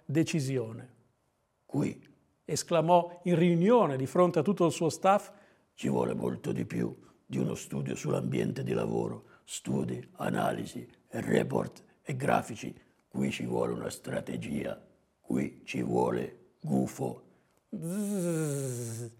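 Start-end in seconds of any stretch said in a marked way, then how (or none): 1.7-2.0 soft, rasping growl of a boss; very low, rough boss voice; hornet boss. Acted. 5.7-17.2 very low, soft creaky growl; rough, hushed boss pronouncement; hornet boss. Acted. Voices Calabrone addressing his staff. 17.7-19.1 short mosquito zzz; mosquito buzz. A narrator's reading. Zanzarina buzzes before speaking.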